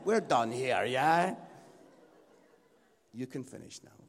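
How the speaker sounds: loud, low comic hint; mock dismissal. Wife at the keyboard ending his sermon, loudly dismissive. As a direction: call time on him, belted out.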